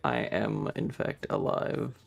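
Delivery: ordinary delivery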